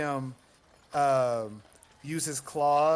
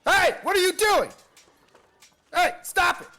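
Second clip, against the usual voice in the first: much higher, louder shout of alarm; yelling at monster; 10 dB louder than usual. Acted. Warlock shouts at the Quasit to stop, loud alarmed. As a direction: bellow it to stop the creature. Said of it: "I'm gonna go".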